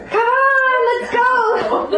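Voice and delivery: high, loud, laughing summons; calling the risen to come along, excitedly. Acted. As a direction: rouse everyone to get up and go. Calling everyone up at resurrection.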